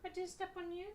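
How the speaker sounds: high, soft apology to a pet. Real, not acted. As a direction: soothe the cat you just stepped on. Apologising after stepping on cat.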